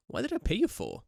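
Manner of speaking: high-pitched, badly faked Game of Thrones accent; hammed-up meme quote in a bad, high Game of Thrones accent; terrible Game of Thrones accent. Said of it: "That was a terrible accent".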